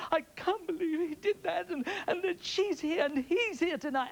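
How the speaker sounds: high, wailing ex-wife on the verge of tears; imitating her tearful outburst. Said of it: "then she gets hysterical".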